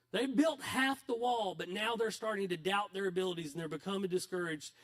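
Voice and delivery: ordinary delivery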